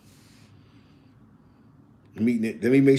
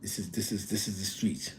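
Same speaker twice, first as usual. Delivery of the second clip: very soft, lower-pitched murmur; quiet aside; 4 semitones lower, 15 dB quieter than usual. Real, not acted. Host talking about the streets between takes, quiet low aside. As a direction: drop well below the hype voice.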